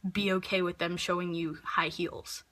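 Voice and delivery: ordinary talk, nothing marked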